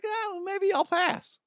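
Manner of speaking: very high, squeaky hesitant brush-off; hammed-up wary decline; mock reluctant refusal. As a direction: politely back out in a comic high voice. Acts out declining dubious crocodile offer.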